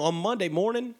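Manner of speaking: ordinary delivery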